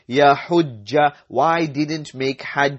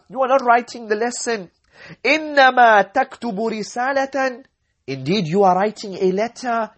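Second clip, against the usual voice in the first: mock scolding teacher; 8 semitones higher than usual. Teacher scolding student in story.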